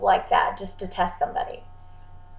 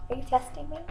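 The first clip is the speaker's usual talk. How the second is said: hushed question; 5 semitones higher, 13 dB quieter than usual. Retold or imitated. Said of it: "and I'll go".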